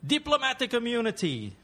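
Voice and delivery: high, drawn-up movie villain claim; defiant film villain. Acted. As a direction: invoke your privilege like a smug film villain. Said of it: "Finish this line".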